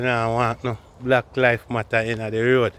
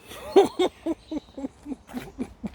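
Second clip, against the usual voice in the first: laugh; 13 semitones higher, 5 dB quieter than usual. Real, not acted. High laugh on the walk.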